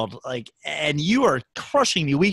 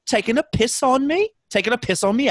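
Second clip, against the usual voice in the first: higher mock British questioning lilt; imitated British accent; 6 semitones higher than usual. Contestant imitates Britons being teased, high mock British accent. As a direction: mimic an indignant Brit, pitch rising at the end. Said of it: "they're like".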